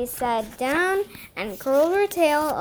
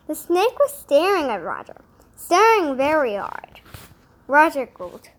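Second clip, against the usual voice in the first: usual speaking voice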